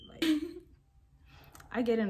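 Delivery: breathy laughing whisper; half-whispered through a laugh; whispery giggle. Real, not acted. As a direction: giggle it out under your breath. Giggly whispered aside in story.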